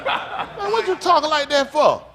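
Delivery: high, laughing jeer; a fan mocks the rapper, high and laughing; laughing heckle. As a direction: heckle him through a laugh.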